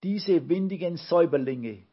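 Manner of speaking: lightly voiced cross kids; indignant call. A narrator's reading. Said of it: "riefen die Olche-Kinder".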